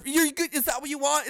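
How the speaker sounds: ordinary talk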